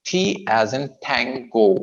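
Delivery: very slow T and Tango, a little h mixed into each T; aspirated T. Shown as a demonstration. Teacher demonstrating Tango, slightly aspirated T. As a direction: stretch Tango, T released with light air. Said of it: "ठ में थोड़ी सी H आप mix कर".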